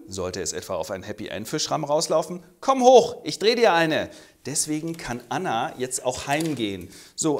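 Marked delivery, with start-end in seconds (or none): 2.6-4.1 high, loud summons; playful call. Acted. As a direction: invite him up from the window. Calling up invitation.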